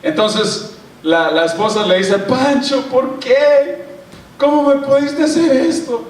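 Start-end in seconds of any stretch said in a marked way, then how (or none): 2.2-6.0 high, wounded wife's lament; wife catching Pancho kissing another, hurt and high; hurt, crying wife. Retold or imitated. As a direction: pitch up high and plead like a betrayed wife.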